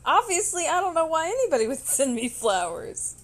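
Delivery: high, loud, worked-up protest; emphatic exasperated outburst; exasperated bafflement. Real, not acted. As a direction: protest loudly with pitch pushed up. Exasperated recounting of her confusion.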